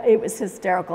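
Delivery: normal talking voice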